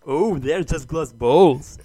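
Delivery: slow, dismissive mock objection; mock objector. Mimicking doubters of marble racing, mock dismissive. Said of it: "some people say".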